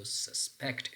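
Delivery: "suspect" stressed on PECT; stress on second syllable. Shown as a demonstration. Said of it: "stress pattern on the second syllable".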